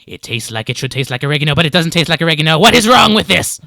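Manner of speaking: fast muttered rant building to loud; ranting lizard man. Acted. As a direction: race along, rising into an exasperated cry. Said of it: "his mumbles raise in volume slightly".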